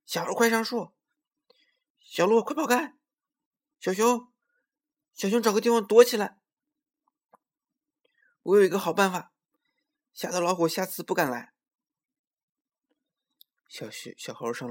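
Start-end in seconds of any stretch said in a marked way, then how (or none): 0.0-11.5 high, loud squirrel calling out; loud shouting. A narrator's reading. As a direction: shout an urgent warning to friends, high-pitched. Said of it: "他大声喊起来".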